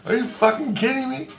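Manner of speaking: high-pitched re-enacted disbelief; incredulous. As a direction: question it in astonishment, voice up high. Acts out disbelief at the news.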